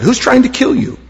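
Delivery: high-pitched, incredulous question; incredulous retort. A narrator's reading. Crowd answers Jesus back, incredulous retort. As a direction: ask it as if the charge is absurd.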